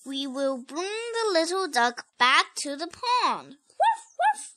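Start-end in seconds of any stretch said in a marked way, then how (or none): 3.8-4.5 high, playful woof; puppy bark. Acted. Child barks as Biscuit.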